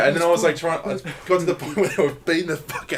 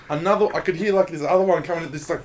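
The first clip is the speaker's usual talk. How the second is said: ordinary talk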